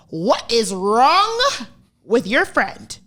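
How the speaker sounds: slow, stretched mock mom; imitating mom drawling the question; mom's demanding question. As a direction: drag it out like a fed-up mom. Mom's accusing phone call.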